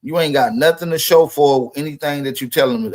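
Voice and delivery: ordinary delivery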